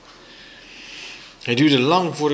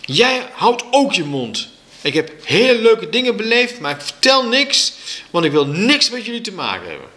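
high, raised angry outburst; kwaad kind; 9 semitones higher, 4 dB louder than usual. A narrator's reading. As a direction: shout them down, furious. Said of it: "buiten adem van kwaadheid". (woedend geroepen)

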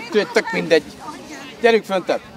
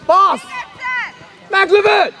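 loud, high-pitched sideline shouting; urgent shout; 9 semitones higher, 5 dB louder than usual. Real, not acted. Coach pushing players to shoot, urgent shouting. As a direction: shout hard to drive the attack on.

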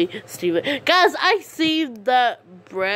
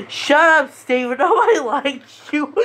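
mock shout; 6 semitones higher than usual. Telling off a commenter.

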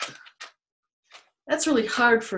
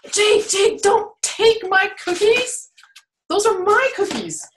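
higher and louder, playful protest; mock scolding; 11 semitones higher, 5 dB louder than usual. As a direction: pretend outrage at a cookie thief. Scolding snake over cookies.

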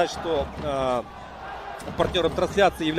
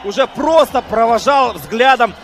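excited replay; 4 semitones higher, 6 dB louder than usual. Real, not acted. Replay of goal, still elated.

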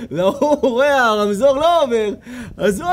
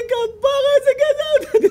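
much higher, excited gushing voice; gushing fan; 3 dB louder than usual. Retold or imitated. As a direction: gush like a starstruck fan. Woman gushing over rabbi.

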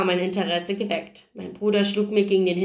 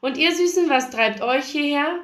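higher-pitched breezy chatter; chatty, flirty; 6 semitones higher than usual.

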